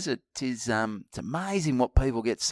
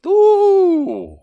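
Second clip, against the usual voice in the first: high, loud impressed ooh; excited ooh at the bid; 10 dB louder than usual. Real, not acted. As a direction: squeal at the price. Reacting to four hundred price.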